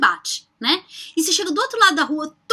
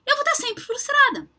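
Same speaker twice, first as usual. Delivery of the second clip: mock whiny; 12 semitones higher than usual. Mock-frustrated outcome.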